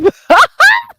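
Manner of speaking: sharp high burst of laughter. Real, not acted. Amused at her own rant, a high squealing laugh.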